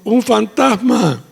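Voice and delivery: drawn-out scared shout of ghost; frightened cry. Retold or imitated. Disciples seeing Jesus on the sea, scared cry. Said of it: "se turbaron diciendo".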